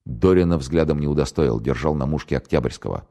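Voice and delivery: plain reading voice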